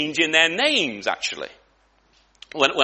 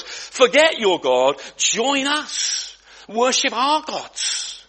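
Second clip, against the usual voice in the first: voice of the world; 6 semitones higher, much slower than usual. Acted. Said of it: "that's saying to us".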